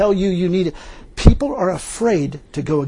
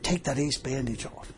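much softer, low command; quiet inner voice; 5 semitones lower, 13 dB quieter than usual. Retold or imitated. A voice speaks to the injured preacher, quiet low instruction. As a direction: say it low and hushed, like a voice within. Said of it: "And then a voice speaks".

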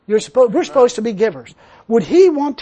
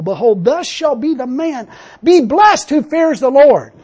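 high, loud preaching of the verses, slowing at the end; 4 semitones higher, 4 dB louder than usual. Acted. Preacher proclaiming the psalm's promise to the congregation, loud and higher. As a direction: proclaim the promise with rising fervor.

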